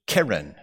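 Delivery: keren said KEH-ren, with two eh vowels; Hebrew noun pronunciation. Shown as a demonstration. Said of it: "the pronunciation is different".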